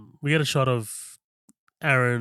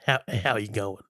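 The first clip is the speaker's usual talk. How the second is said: lightly put-on broad Australian greeting; Eric Bana's Australian; 4 semitones lower than usual. Shown as a demonstration. Co-host imitating Eric Bana's accent in the movie, lightly Australian. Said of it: "I'm gonna try and do it".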